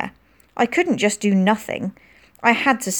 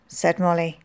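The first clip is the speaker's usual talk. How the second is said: ordinary delivery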